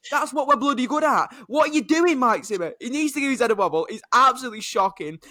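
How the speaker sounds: high, loud frustrated outburst; exasperated rant. Real, not acted. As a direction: vent at the coach in disbelief. Fan ranting about his defense.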